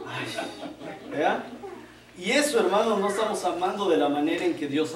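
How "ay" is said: whispered exasperated sigh. Retold or imitated. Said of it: "empiezan a hacer sonido".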